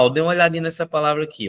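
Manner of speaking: ordinary talk, nothing marked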